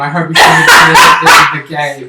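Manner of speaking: alarmed emphasis. Real, not acted. Alarmed reaction to shooting joke.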